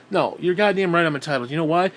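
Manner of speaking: ordinary delivery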